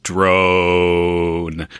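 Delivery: drawn-out drone. Shown as a demonstration. Cohost suggesting the audio to use as a response, droning. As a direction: DRONE stretched out long on one level note.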